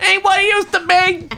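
very high, laughing mock whine. Shown as a demonstration. Explaining his voice, a very high mock local whine. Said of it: "that high voice is when I'm".

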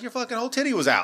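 incredulous rapid squeak; exasperated. Retold or imitated. Teller defending himself to crying girlfriend, high fast protest. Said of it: "And I'm like".

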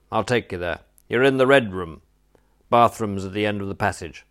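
usual speaking voice

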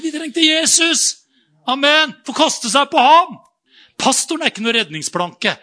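loud, high, fervent sermon; full force preaching. Acted. Pastor preaching loudly to the church about Jesus. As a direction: thunder it to the congregation.

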